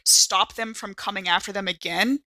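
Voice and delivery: ordinary talk, nothing marked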